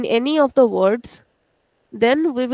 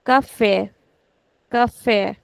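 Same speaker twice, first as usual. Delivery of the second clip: café with FÉ stretched; stress on last syllable; slower than usual. Shown as a demonstration. Teacher models café for students, stretching the last syllable. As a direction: lean on fé at the end of café. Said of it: "I am actually putting stretch on the last syllable".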